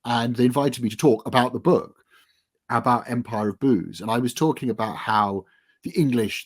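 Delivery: ordinary talk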